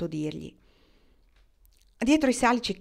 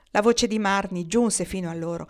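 plain reading voice

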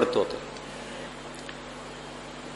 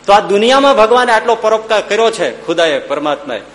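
rousing challenge; 6 semitones higher, 3 dB louder than usual. Real, not acted. Preacher challenges the audience.

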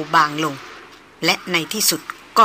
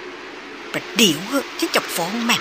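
slow, softer, teasing tattle-tale threat; mock childish threat; 6 dB quieter, much slower than usual. A narrator's reading. The old officer pointing at Chaiyan, mock threatening like a kid. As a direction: pretend to be a child telling on him. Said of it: "แล้วชี้หน้าบอกว่า".

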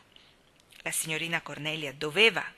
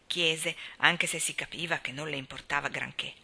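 ordinary delivery, nothing marked

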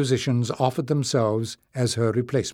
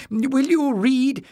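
put-on much higher, slow pleading old man; urging old librarian; 13 semitones higher, 3 dB louder, slower than usual. Old librarian urges the king to read, high and slow. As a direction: coax the king gently but insistently. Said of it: "urged Joseph".

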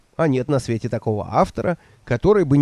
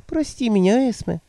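put-on higher, timid old woman voice; meek old woman; 9 semitones higher than usual. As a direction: answer meekly, eager to please. Nanny voiced by narrator.

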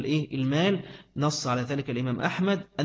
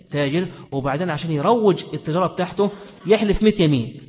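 ordinary talk

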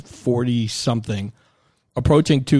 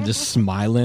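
ordinary talk, nothing marked

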